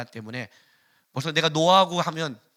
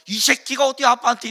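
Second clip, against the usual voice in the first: put-on higher, louder angry father snapping at his child; a father losing his temper, raised voice; 8 semitones higher, 7 dB louder than usual. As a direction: flare up at the child. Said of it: "나도 노하면".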